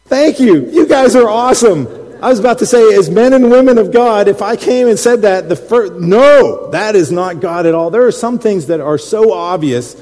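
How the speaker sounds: loud, high preaching voice. Acted. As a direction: preach it to the crowd. Preaching at full force.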